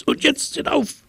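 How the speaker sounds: very high squeaky command; a tiny mouse squeaking orders. A narrator's reading. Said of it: "fiepte die Maus".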